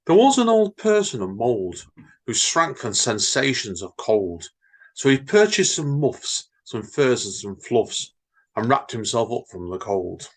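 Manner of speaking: bouncy sing-song limerick reading; jaunty comic verse reading. Acted. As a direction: read the nonsense verse with playful rhythm. Reads Edward Lear limericks.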